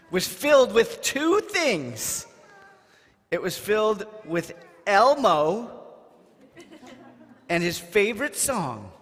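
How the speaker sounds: high, bright speech to the little ones; big excited voice for the kids; announcing to children. Acted.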